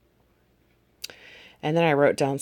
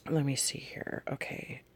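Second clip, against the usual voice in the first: softly under the breath; muttering to self; 8 dB quieter than usual. Real, not acted. Searching through notes for the entry, muttering to self. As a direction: mumble quietly while looking for your place. Searching her notebook, talking to herself.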